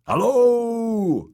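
loud, high drawn-out holler; long, high carrying call. A narrator's reading. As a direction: shout it down into the darkness. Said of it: "he called again".

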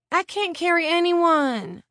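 put-on high, slow whining like a tired kid; whiny child. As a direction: whine it like a worn-out little child. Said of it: "sounding like a whiny child".

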